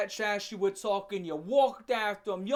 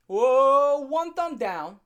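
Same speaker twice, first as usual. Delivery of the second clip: loud excited whoa, then thumbs down; hyped verdict; 5 semitones higher, 9 dB louder, much slower than usual. Real, not acted. Vlogger rating the fight for viewers, loud and hyped. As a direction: announce the rating like a hype host.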